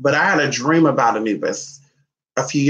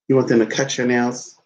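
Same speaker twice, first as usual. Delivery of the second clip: ordinary talk, nothing marked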